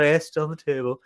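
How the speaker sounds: passive-aggressive. Retold or imitated. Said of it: "passive-aggressively left it on the table".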